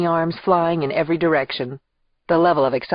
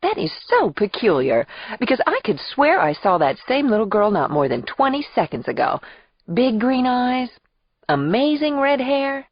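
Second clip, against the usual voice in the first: high, swooping mock puzzlement; hammed-up theatrics; 8 semitones higher than usual. Aunt teasing her hidden toddler niece, theatrical mock puzzlement. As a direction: play baffled like a soap opera actress. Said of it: "That's about all the theatrics".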